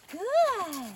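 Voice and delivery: very loud, high, stretched praise; emphatic praise. Real, not acted. Rider praising her nervous horse, emphatic praise. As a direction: burst out with one long word of praise.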